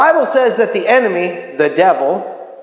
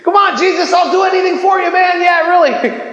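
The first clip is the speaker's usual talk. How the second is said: brash heckler; 10 semitones higher than usual. Retold or imitated. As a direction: blurt it out with big bravado. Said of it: "kind of Peter syndrome".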